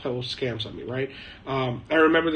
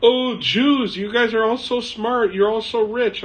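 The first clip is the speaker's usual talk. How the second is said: higher-pitched mock amazement; imitated admirers; 8 semitones higher, 4 dB louder than usual. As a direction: gush like an impressed admirer, exaggerated. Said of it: "the first things they say is".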